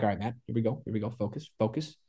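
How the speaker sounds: very soft, under the breath; quietly steady yourself to focus; self pep talk. Retold or imitated. Golfer talking himself into focus, hushed self pep talk.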